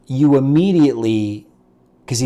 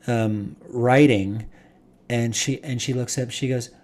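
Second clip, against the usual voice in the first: usual speaking voice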